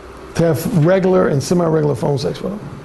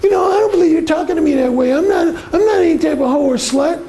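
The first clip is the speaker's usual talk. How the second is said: high, fast, prissy outraged woman voice; high mock indignant woman voice; mock offended woman; 11 semitones higher than usual. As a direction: play the scandalised good girl, hammed up. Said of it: "those theatrical reactions like".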